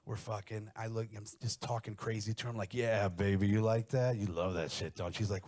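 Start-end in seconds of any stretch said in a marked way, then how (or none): 2.7-5.2 loud, cocky bedroom talk. Retold or imitated. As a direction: imitate over-the-top crazy sex talk, loudly.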